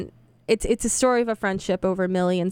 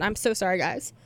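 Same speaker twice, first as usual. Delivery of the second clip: normal talking voice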